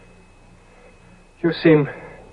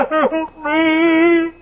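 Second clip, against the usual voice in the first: despairing cry for help; 9 semitones higher, much slower than usual. Acted. Dorian alone, breaking down.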